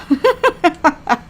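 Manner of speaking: high, light laughter; gleeful giggle. Acted. Onlooker laughing at floating patient, gleeful giggle. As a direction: giggle at the spectacle.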